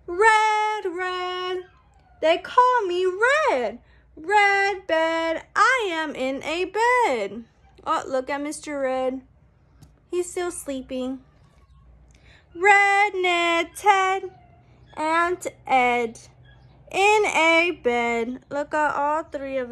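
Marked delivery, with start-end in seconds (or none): 0.1-7.4 bright, sing-song picture-book reading; reading to children. A narrator's reading. Book text read aloud. 12.5-18.4 bright, sing-song picture-book reading; reading to children. A narrator's reading. Book text read aloud.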